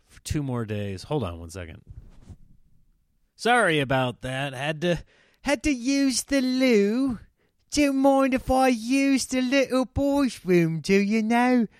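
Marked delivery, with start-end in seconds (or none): 5.4-11.7 high, slow mock English accent; hammed-up English accent, drawn out and loud. Excusing a trip to the loo, mock English accent. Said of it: "Sorry for the English accent".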